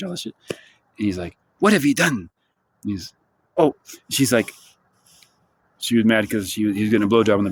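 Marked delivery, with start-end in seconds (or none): 1.6-2.3 imitated French villain demanding answers; a hint of an outraged Frenchman. Merovingian confronting his wife after the escape, mock French outrage. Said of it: "he's speaking in French, cursing in French".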